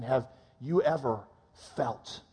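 ordinary delivery

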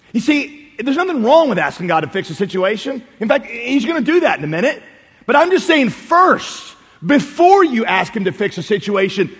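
ordinary talk, nothing marked

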